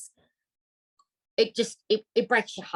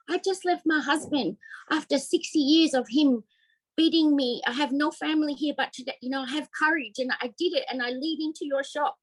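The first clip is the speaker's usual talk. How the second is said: lightly voiced old woman, higher, tender and brave; elderly lady confiding; 9 semitones higher than usual. Old customer tells the shop owner her story, lightly voiced.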